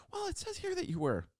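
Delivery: very high put-on administrator voice; high-pitched, hesitant bureaucrat impression; mock official. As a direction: imitate an official delicately reading out a complaint. Office reads out the complaint.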